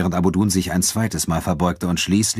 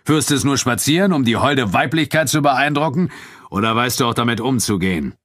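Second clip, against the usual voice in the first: higher-pitched, projected taunting; Abu Dun calling out; 6 semitones higher, 3 dB louder than usual. A narrator's reading. Nubian fighter mocks his friend's sword, calling out. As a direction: goad him in front of everyone. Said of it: "rief Abu Dun".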